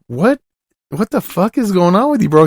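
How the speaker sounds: exasperated disbelief. Retold or imitated. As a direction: throw it up high, can't believe this guy. Worker refuses to make sandwich.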